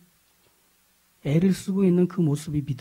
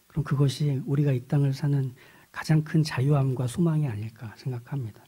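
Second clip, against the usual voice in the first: normal talking voice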